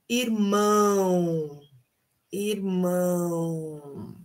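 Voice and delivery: irmão with a long nasal ão, drawn out slowly; slow nasal demo of irmão. Shown as a demonstration. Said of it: "som nasal nas palavras".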